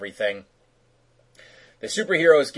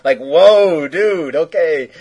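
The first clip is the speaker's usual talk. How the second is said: mock shock; 5 semitones higher, 9 dB louder, slower than usual. Real, not acted. Shocked reaction to pervy line.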